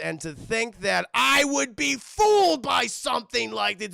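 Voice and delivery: loud, slow enraged yell; furious shout. Retold or imitated. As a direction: bellow in betrayed rage. Maxie realizes the trick.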